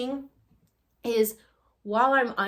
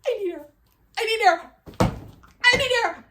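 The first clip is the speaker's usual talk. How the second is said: high, breathless cries getting louder; gasping for air; 11 semitones higher, 5 dB louder, faster than usual. Retold or imitated. Grabbing the air for air, gasping desperately. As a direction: gasp it out, building in desperation.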